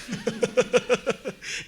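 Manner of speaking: high amused laugh; bursting laugh. Real, not acted. Laughing at failed Google Lens.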